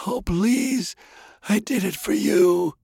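put-on very high, imploring old man; desperate pleading. A narrator's reading. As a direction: beg from your deathbed. Dying grandfather pleads.

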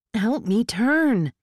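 high, stretched cry for help; urgent shout. Acted. Tinker cannot turn the truck alone, urgent shout.

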